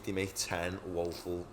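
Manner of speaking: usual speaking voice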